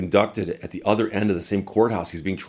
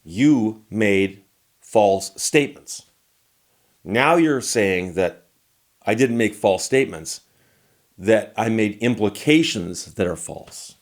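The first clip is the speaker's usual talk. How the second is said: slow, lawyerly point; slower than usual. Retold or imitated. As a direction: spell out "you made false statements" slowly. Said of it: "I said".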